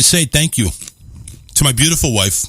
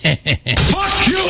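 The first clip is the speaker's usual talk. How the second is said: playful shout; 2 semitones higher than usual. Acted. Playful insult to caller John.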